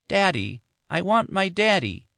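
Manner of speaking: put-on a small child asking for her daddy; toddler voice. Little Amy asking her mother for daddy, high plaintive toddler voice. Toddler asks for dead father.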